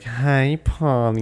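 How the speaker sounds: a hint of a sultry woman, sighing the name; sexy female voice. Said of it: "like, a sexy female voice".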